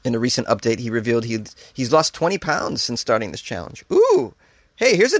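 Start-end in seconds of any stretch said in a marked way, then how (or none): 3.9-5.2 sudden high excited ooh; excited squeal. Real, not acted. Host squealing at a new diet idea for viewers.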